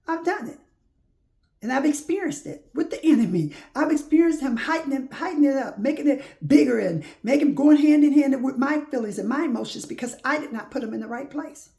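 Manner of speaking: high, loud testimony; fervent preaching. Real, not acted. Preacher testifying about the enemy, loud and high. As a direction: preach it hard, voice lifted.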